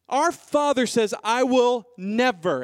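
loud, high, punched declaration; emphatic declaration. Acted. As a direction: proclaim God's promise, drawing out the key words. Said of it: "It's an emphatic expression".

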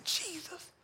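high, weeping cry of the name; a wife weeping out the name at her husband's deathbed. Retold or imitated. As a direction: let the name break into a sob. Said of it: "begins to weep hard and say".

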